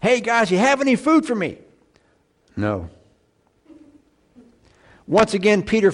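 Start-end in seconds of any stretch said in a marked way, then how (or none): 0.0-1.6 high-pitched mock hail to the guys; lightly acted, high-pitched call; calling to fishermen. Acting out Jesus calling to fishermen. 2.6-2.9 low, deflated one-word reply; curt, frustrated low no. Acted. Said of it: "Can't you hear the frustration in their voice?".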